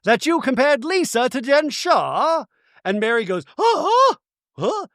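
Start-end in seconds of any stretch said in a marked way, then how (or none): 0.0-2.4 high-pitched put-on voice; mock tattletale. Imitating a cast member answering Angie, mock tattletale. 3.6-4.9 very high-pitched squeaked huh; exaggerated imitation of a stunned reaction; mock shock. Imitating Mary hearing the gossip, mock shock.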